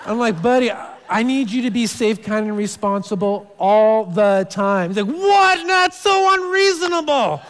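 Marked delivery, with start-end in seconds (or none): 0.4-4.9 slow patient dad. Retold or imitated. Dad repeating rule slowly. 5.2-7.4 put-on much higher, louder indignant child whine; outraged kid. Son Max complaining to dad, high indignant whine.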